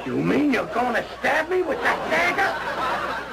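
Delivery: high, drawn-out horrified question; alarmed. Acted. Patient to nurse holding the needle, alarmed. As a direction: recoil in comic dread from the needle.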